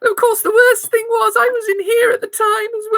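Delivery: put-on high, earnest old lady; granny insisting on her alibi. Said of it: "my old granny voice".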